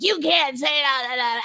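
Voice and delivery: high, protesting mock outburst; combative fighter. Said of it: "becoming this, like, huge fighter".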